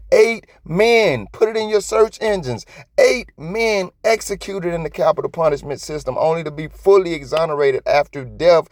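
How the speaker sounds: high-pitched, fervent rant; impassioned rebuke. Real, not acted. Scolding listeners who assume anyone arrested is guilty, impassioned. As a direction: press each point with real indignation.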